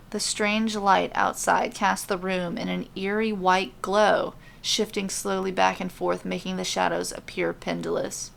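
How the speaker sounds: ordinary delivery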